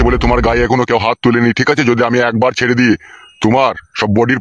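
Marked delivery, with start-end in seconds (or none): none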